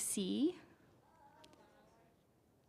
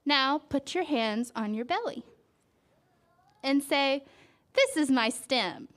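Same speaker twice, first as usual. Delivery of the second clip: slow, gentle picture-book read; reading to a child; slower than usual. A narrator's reading. Parent reading a flower book to a child, gentle storytelling. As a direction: draw the child into the page.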